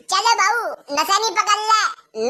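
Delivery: usual speaking voice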